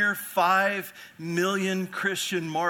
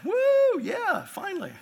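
high-pitched whoop of fake joy, louder; mock excitement; 4 dB louder, slower than usual. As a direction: cheer it like it's great news. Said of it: "You're like".

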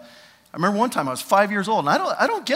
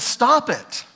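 raised, firm stop; emphatic apostolic command; 8 semitones higher, 6 dB louder than usual. Retold or imitated. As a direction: command it sharply. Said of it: "He just says".

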